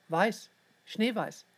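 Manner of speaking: ordinary delivery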